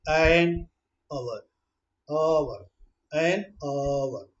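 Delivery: slow and soft, each word drawn out; slow motion. Shown as a demonstration. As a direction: pull every syllable out slowly. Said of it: "इसकी slow motion देखिए".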